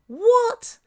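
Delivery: high, loud incredulous exclamation; shocked disbelief. Real, not acted. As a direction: burst out in outraged astonishment. Shocked reaction to the revelation.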